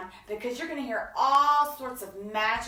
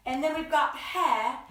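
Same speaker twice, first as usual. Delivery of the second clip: plain reading voice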